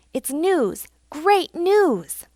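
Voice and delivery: high, excited announcement; animated, pitch-leaping exclamation; excited cry. A narrator's reading. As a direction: burst out with the news. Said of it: "cried Little Joe".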